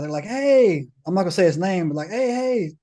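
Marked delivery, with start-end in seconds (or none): 0.3-0.9 high, drawn-out "hey" to needle him; high teasing call; mock taunting. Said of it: "start going in on this guy". 2.1-2.7 high-pitched ribbing of a buddy; teasing singled-out questioning, pitched up; mock taunting. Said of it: "start going in on this guy".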